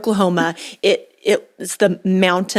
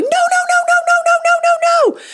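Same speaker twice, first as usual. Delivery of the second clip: very high-pitched, rapid squealed string of no's; retold comic panic, raised voice; laughing mock panic; 4 dB louder than usual. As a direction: squeal helplessly as you head for the rocks. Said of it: "I was just laughing, going".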